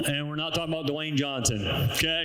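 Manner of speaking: ordinary delivery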